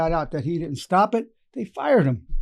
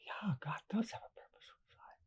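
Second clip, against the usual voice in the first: whispered, much softer, with quiet wonder; awed whisper; 3 semitones higher, 20 dB quieter than usual. Retold or imitated. Mother who stopped crying, whispering her new belief. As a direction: breathe it out in a whisper as the truth lands.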